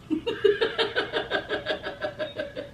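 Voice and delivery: airy, silent wheeze of a laugh; breathy laugh. Real, not acted. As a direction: laugh almost without voice. Laughing at Quay walking four miles.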